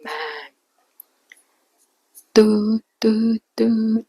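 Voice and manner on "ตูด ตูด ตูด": imitated phone ring, higher and louder. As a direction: beep out a ringing phone tone. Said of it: "เสียงโทรศัพท์ของขนมปังก็ดังขึ้น". (เลียนเสียงโทรศัพท์ดัง ตูด ตูด)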